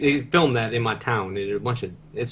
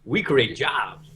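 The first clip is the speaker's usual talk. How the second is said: put-on southern accent; 3 semitones higher than usual. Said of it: "I love that accent".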